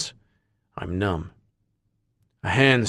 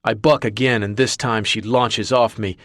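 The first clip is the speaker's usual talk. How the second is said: ordinary delivery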